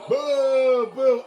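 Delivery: loud, high booing; loud playful booing; mock boo. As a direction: boo the joke loudly. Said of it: "here's another boo".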